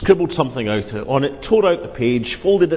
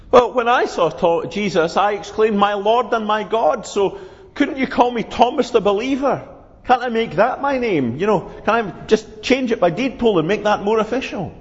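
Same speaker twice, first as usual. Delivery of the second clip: higher, eager Thomas; Thomas pleading; 5 semitones higher than usual. Acted. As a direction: ask for the new name, raised and hopeful. Thomas voiced in story.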